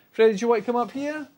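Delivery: high, fast coaxing call; coaxing the pet. Real, not acted. Owner calls Freddy up in a high quick coax. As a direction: lure the dog up.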